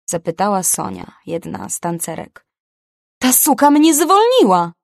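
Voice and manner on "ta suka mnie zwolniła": screamed, much higher and louder; fired dancer screams to her colleague in fury; furious yell. Acted. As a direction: explode with rage. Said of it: "Wrzasnęłam".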